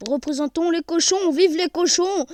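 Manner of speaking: loud cheering chant; rally the crowd for the pigs. Real, not acted. Gamer cheering for people from the Sarthe, loud. Shout-out chant for his region.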